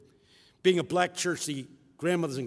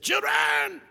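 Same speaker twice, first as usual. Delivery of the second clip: high, loud pastor's call; a pastor's booming call to the young; imitating his pastor; 8 dB louder, much slower than usual. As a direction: call out the children like the old pastor. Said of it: "He talks like that".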